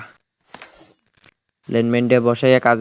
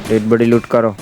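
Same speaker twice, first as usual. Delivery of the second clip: louder repeated order; loud call; 7 dB louder than usual. Real, not acted. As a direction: call it out to the squad.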